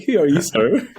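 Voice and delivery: a high, put-on retold voice asking who he is; mock posh query. Guest mimics Brundle meeting a stranger, high put-on voice. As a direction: lift it into a laughing mock-posh sir.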